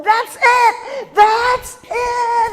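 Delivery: loud high that's it; family jumping with joy; loud high overjoyed shout; excited shout. Retold or imitated. Family recognizing song, elated.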